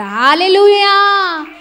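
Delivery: a preacher's rousing loud cry of praise; loud hallelujah. Real, not acted. Preacher rousing the congregation: loud high hallelujah.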